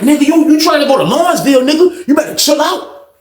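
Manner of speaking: high-pitched mock threat; cocky, high-voiced warning; bucking up. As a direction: imitate him squaring up, voice way up. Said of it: "trying to act like he bucking up at me".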